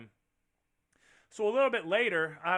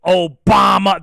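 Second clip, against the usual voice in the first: very loud, punchy bellow; booming demonstration of a name; powerful name shout; 3 semitones higher, 16 dB louder, slower than usual. Shown as a demonstration. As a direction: belt out the name to show its power.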